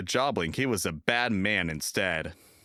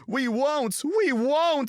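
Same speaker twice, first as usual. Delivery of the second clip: much higher, louder screaming; screaming trucks; 5 dB louder, slower than usual. A narrator's reading. Trucks refusing James, screaming. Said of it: "screamed the trucks".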